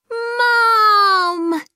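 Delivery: high, loud yell for Mum. A narrator's reading. Kipper calling Mum upstairs, loud yell. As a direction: shout for Mum from bed. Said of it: "yelled Kipper".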